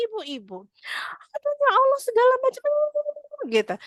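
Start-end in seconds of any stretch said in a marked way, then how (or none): 1.3-2.6 high, louder rushed rant; fast angry wife. Retold or imitated. Annoyed wife ranting at husband, high and rushed. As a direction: rattle it off in a fury. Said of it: "ngomongnya tuh cepet banget".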